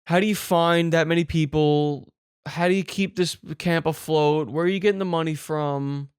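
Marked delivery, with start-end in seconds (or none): none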